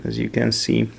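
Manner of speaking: ordinary talk, nothing marked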